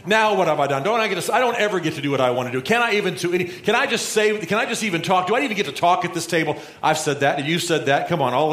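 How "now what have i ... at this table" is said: put-on higher, pleading kid-brother whine; hammed-up sulky little brother griping to his siblings; whiny little brother. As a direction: complain like the youngest at the dinner table. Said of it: "everybody who's got an older brother or sister has said that kind of thing".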